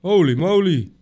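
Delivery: high startled exclamation; holy moly. Real, not acted. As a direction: caught off guard by the door.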